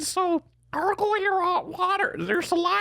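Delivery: very high gargling squid voice; gurgly squid voice. Pretend. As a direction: talk like a squid through water. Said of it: "we all have to talk like squid".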